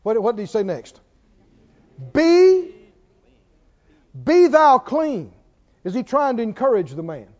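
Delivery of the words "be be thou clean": loud, slow proclamation; high, forceful decree; commanding. Retold or imitated. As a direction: proclaim it as a command, each word drawn out. Quoting Jesus' healing command.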